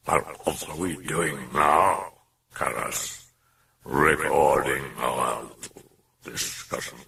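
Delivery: slow, low, whispery demon; low slow demonic purr. Acted. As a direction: purr the question menacingly.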